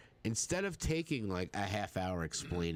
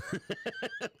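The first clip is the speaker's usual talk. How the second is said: sudden high laugh; high burst of laughter. Real, not acted. Laughing at the name Agnes.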